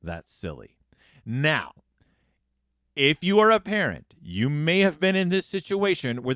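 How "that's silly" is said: low, soft mock mutter under the breath. Sister muttering back at mama over the raisins. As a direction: grumble it under your breath, half-heard. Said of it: "responds by muttering".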